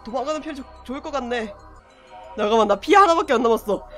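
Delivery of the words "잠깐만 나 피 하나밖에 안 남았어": panicked fast. Real, not acted. Panicking at low health.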